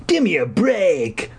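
put-on slow, high boyish dismissal; muttered. Corey brushing off Sam, slow and high. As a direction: brush it off coolly. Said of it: "Corey muttered".

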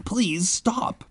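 high-pitched plea; pleading. A narrator's reading. Ben and narrator to the dancing kids, pleading. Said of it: "Ben and I both pleaded".

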